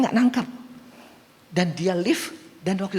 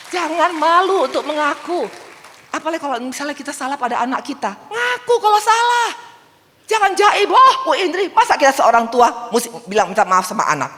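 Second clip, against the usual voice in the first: preaching shout; 10 semitones higher, 7 dB louder than usual. Acted. Preacher calling out to the congregation, loud and high. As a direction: proclaim it to the hall.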